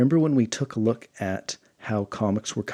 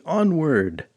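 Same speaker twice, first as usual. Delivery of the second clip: battle cry; 6 semitones higher, 4 dB louder than usual. Acted. Queen rallying her army to attack, onward cry. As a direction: raise the rallying cry, pitched up.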